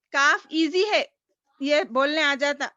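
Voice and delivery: normal talking voice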